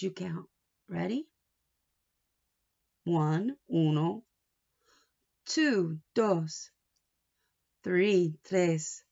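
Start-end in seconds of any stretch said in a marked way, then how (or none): none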